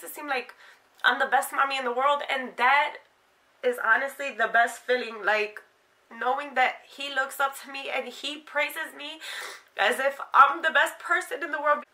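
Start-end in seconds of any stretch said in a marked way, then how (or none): none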